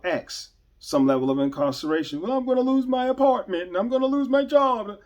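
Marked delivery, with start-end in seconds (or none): 2.2-5.0 high, pleading offender voice; mock the whining of someone caught out; pleading excuse. Imitating an offender begging off his penalty, high and pleading.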